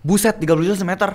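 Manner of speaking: high, disbelieving exclamation; shocked. Real, not acted. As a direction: react in shock to the price. Shocked at Jakarta land price. (kaget tak percaya, nada tinggi)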